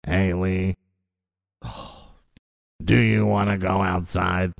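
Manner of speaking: slow low talk-box robot voice. Retold or imitated. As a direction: drone it out like a robot. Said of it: "she talk like a robot".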